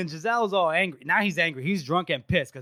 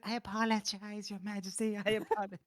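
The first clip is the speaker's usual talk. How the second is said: meek mumbled apology; 3 semitones higher, 9 dB quieter than usual. Retold or imitated. Injured councillor apologizing.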